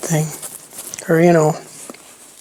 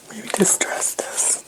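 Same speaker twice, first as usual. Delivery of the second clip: whispering; 10 dB quieter, slower than usual. Real, not acted. Musing to herself while crafting.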